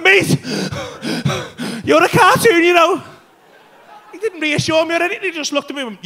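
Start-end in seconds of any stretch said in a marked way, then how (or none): 0.0-3.0 loud high frantic pleading; panicked shout. Retold or imitated. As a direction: yell it in panic. Panicked explanation.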